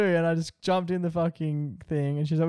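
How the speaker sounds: ordinary delivery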